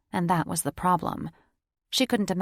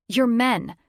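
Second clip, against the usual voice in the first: sharp, louder spat words; 5 semitones higher, 6 dB louder than usual. Acted. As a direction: spit the words at him. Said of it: "she spat".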